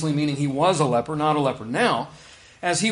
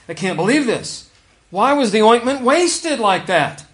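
mock indignant grumble, higher and louder; the onlookers scoffing at the wasted perfume, mildly indignant; 6 semitones higher, 6 dB louder than usual. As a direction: huff it out like offended onlookers. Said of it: "they said to themselves indignantly".

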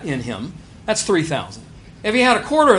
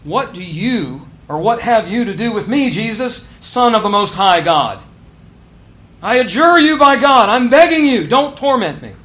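loud, higher, desperate demon's plea; demon screaming; 5 semitones higher, 8 dB louder than usual. Acted. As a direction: cry out begging not to be tormented. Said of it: "the biblical way of saying he's screaming".

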